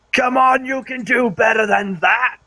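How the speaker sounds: high, drawn-out battle shout. Acted. Player shouts in character across the battle, shouting. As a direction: call it out high and goading. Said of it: "all of my shouting, I've been shouting".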